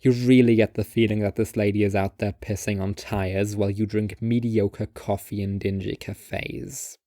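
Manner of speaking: ordinary delivery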